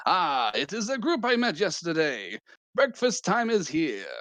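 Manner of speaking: high, bright cheerful cook; chef character voice. Acted. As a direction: sing out a jolly greeting. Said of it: "you hear a very familiar voice".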